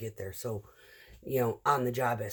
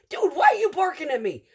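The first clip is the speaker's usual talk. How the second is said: high, loud indignant retort; retold argument; 13 semitones higher, 9 dB louder than usual. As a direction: push back hard, voice up high. Retold quarrel with coworker.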